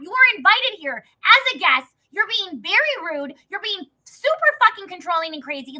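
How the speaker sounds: high, loud, emphatic tirade; acted-out furious telling-off; in-her-face confrontation. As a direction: square up and yell each declaration. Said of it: "I get in her face".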